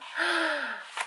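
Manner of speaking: sharp high gasp of delight; excited gasp. Real, not acted. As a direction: gasp as the lid opens. Opening the palette.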